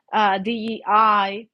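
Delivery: ordinary delivery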